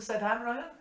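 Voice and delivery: loud, high, ringing recital; bush poem recitation. Acted. Reciting Says Hanrahan for parishioners, loud declaiming. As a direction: declaim the ballad to the whole parish.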